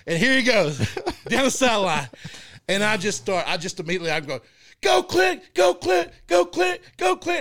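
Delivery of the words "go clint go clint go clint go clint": high, loud cheering yell, repeated; screaming cheer. Retold or imitated. Dad cheering his son's touchdown run from the field, shouting high and loud. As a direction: cheer your kid down the sideline at full voice. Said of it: "I just screamed that".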